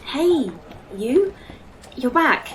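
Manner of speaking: surprised relief as he comes to; relieved surprise. Acted. Alice wakes from the machine.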